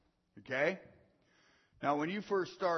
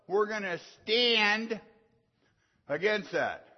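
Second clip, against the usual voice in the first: rising preaching; 3 semitones higher, 8 dB louder than usual. Real, not acted. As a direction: build the sermon back up. Preaching builds again.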